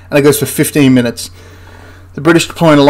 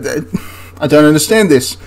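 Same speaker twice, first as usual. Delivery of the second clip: normal talking voice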